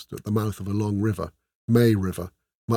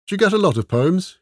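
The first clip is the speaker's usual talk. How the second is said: a hint of a lighter, higher young thief; curious, eager question in a higher voice; lightly voiced Ola; 9 semitones higher, 4 dB louder than usual. A narrator's reading. Ola asks Esmeralda about her poems, lightly voiced and curious.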